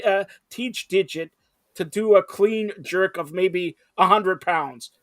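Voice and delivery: ordinary talk, nothing marked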